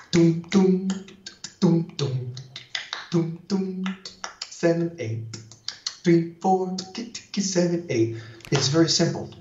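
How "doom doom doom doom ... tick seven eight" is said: doom on the accented beats, spaced out; vocalized mambo bass. Shown as a demonstration. Teacher showing dancers the mambo accents, rhythmic bass sounds. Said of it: "Mambo has the bass accentuating three, four, seven, eight".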